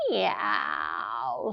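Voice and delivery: a sly, low cat meow. Acted. Stalking cat meowing softly. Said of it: "said the cat".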